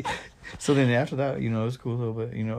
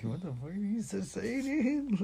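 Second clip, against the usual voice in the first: high-pitched confused quote; 10 semitones higher, 6 dB quieter, slower than usual. Retold or imitated. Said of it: "You're like".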